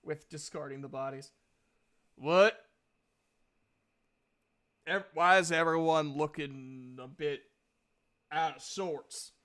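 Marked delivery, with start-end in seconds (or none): 2.1-9.3 a loud, puzzled What, then the question; returning guard's loud surprised question; puzzled Duke. Acted. Duke the guard, back from the bodies, to the party: loud puzzled question.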